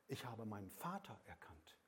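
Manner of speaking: ordinary talk, nothing marked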